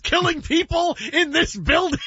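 high-pitched, disbelieving question; mock incredulous. Host acts out the TV show's [name] scene, mock incredulous. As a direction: squeal the question in comic disbelief. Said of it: "[name] thought [name] was the killer".

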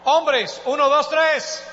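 loud, high count-in; rousing crowd-leader's call; rallying the crowd. Real, not acted. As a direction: shout the cue to the whole congregation. Cues women, then men to shout.

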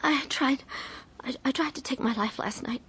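hushed confession. Acted. Wife confesses overdose to husband, hushed and halting. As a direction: barely get the words out, ashamed.

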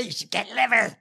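hammed-up impression of a politician; Kennedy. Senator advising people on cheaper food, mocking imitation. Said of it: "it was like Kennedy".

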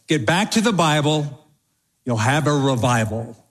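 ordinary delivery

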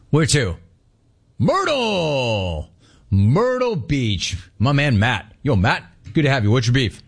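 ordinary talk, nothing marked

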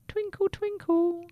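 very high, airy whisper; a twinkly little whisper; tiny star voice. A narrator's reading. Second star answering the lost toothbrush in a tiny whisper.

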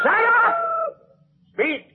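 very high, furious order; exasperated yell. Acted. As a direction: yell for silence, then command. Director silences animal, orders actors on.

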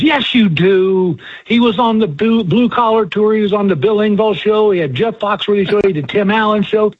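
a lightly voiced older woman who won't let it go; insistent fan. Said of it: "And the lady goes".

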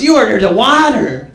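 mock outraged fast-food boss; accusing manager. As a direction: accuse a customer, mildly hammed up. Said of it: "And he goes".

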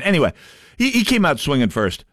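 ordinary delivery

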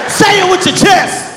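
high, slow challenge; confrontational uncle. Retold or imitated. Uncle cuts in on nephew's drink order, confrontational. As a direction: push it out high and drawn-out.

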